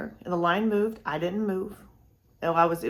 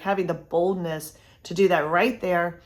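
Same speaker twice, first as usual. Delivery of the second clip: ordinary talk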